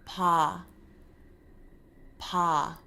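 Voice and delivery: Pa with loose, soft lips. Shown as a demonstration. Teacher showing the softened Korean B. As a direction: let Pa fall out softly. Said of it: "soften your lips".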